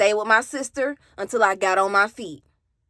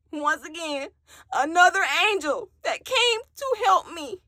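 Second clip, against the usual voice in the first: tearful; 5 semitones higher, 4 dB quieter than usual. Real, not acted. Tearful memory of a rescuer.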